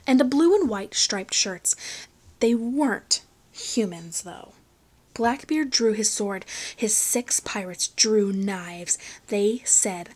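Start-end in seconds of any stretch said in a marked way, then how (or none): none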